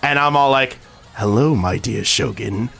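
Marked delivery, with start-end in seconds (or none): 1.1-2.7 low, slow, drawn-out theatrical hello; put-on suave greeting. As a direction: greet them slowly in a put-on deep voice. Acts out his greeting on surprising Mel.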